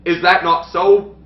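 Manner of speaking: overdone Shakespearean king; hammy stage actor. Acted. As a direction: ham up the pompous king. S1 plays the king.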